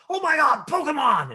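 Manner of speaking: high, loud gleeful shriek; excited squeal. Retold or imitated. Imitating a kid student excited about his new game. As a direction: shriek with delight over the game. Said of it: "then he'll say".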